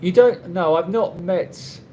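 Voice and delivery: ordinary talk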